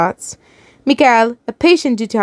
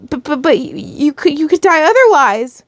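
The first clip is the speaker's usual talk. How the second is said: high, stammering, distressed plea; tearful response; 6 semitones higher than usual. A narrator's reading. Daughter to wounded mother who could die, tearful. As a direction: stammer upward into a desperate protest. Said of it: "you tearfully responded".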